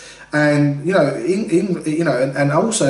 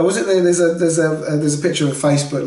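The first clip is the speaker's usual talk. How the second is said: ordinary delivery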